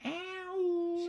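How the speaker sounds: high squeaky cat ow; cat meow as ow. Retold or imitated. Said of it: "sounds like she's saying".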